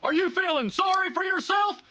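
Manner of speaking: normal talking voice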